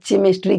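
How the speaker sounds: केमिस्ट्री with "ch", as "che-mestri"; mistaken pronunciation. Retold or imitated. Students asking sir why not read it so, mistaken pronunciation. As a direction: start केमिस्ट्री on "ch" instead of "k". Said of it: "बहुत सारे बच्चे बोलते".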